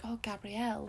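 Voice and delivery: a touch of tenderness; with love. Retold or imitated. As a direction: say it like a cherished sister. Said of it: "with love in her voice".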